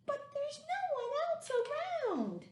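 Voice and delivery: very high little monster voice; a tiny monster whispering worry; squeaky puzzled monster. Acted. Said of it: "And the pink one said".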